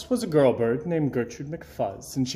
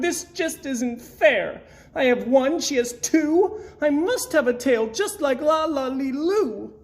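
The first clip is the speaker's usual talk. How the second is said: stamp and shout it's not fair, pitched way up; 10 semitones higher than usual. A narrator's reading. Gertrude shouting in jealous anger at the unfairness. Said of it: "she shouted".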